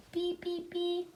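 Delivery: high soft beep beep; horn beeps. Acted. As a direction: squeak like a toy horn. Horn sound in story.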